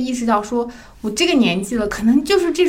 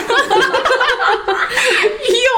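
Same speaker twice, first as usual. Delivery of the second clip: high, louder laugh at herself; 13 semitones higher, 4 dB louder than usual. Real, not acted. To the hosts about crying again, high embarrassed laugh.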